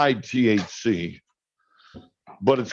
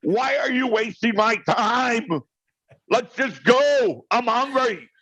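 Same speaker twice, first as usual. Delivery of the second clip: mock-exasperated husband, much higher; exasperated outburst; 11 semitones higher than usual. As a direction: throw up your hands, done with the dithering. Jim's exasperated outburst at wife.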